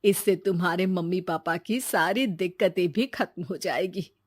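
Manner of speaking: put-on elderly grandmother voice; resigned old woman reasoning with her grandson. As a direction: gently refuse as an old grandmother.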